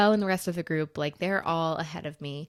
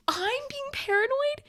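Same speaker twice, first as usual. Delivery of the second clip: very high, drawn-out retold comeback; outraged disbelief; slower than usual. Retorting to Joe's accusation, outraged disbelief. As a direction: throw it back in high, slow indignation.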